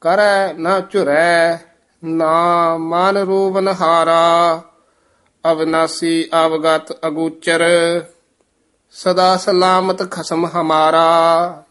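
measured Gurbani reading with drawn-out endings; sustained, chant-like Punjabi scripture reading; scripture recitation. Shown as a demonstration. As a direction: recite phrase by phrase, pausing after each.

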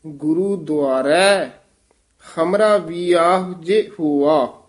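careful break after ਦੁਆਰੈ, stop after ਹੁਆ; ਗੁਰੂ ਦੁਆਰੈ falls, pause, then ਹਮਰਾ ਵੀ ਆਹੁ ਜੇ ਹੁਆ; marked pauses. Shown as a demonstration. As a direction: teach the phrasing, resting after ਦੁਆਰੈ and ਹੁਆ. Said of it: "ਇੱਥੇ ਵਿਸਰਾਮ ਹੈ".

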